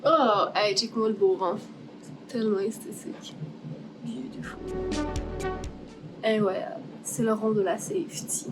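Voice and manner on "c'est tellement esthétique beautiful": whisper. Real, not acted. Admiring the sanded circle.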